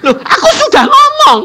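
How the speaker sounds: very high put-on answer; voice of God. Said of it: "aku tadi bilang gini". (suara Tuhan yang tinggi)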